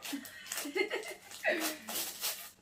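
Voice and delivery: long high giggle; real laughter. Real, not acted. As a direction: crack up, breathy and high. Laughs after the bad chocolate. (fou rire aigu)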